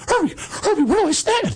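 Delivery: high, pleading falsetto; squeaky mock panic. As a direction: beg for help like a lost kid. Lost boy begs coach for help.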